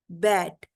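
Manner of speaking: बैट as one low, separate beat; single syllable. Shown as a demonstration. Teacher proving it has one syllable, बैट in one beat. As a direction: set बैट apart as one sound. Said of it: "then it has one syllable".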